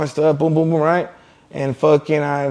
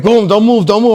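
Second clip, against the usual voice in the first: louder, higher, urgent command; imitated police order; 5 semitones higher, 6 dB louder than usual. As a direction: bark it like an arresting officer. Imitating police ordering him during arrest.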